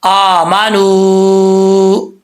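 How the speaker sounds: आमानू very slow, long madd on the first vowel; Quranic recitation. Shown as a demonstration. Tajweed teacher recites आमानू for the class, slow and drawn out. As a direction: chant आमानू with the full stretched alif. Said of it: "सही तरीके से पढ़ना है".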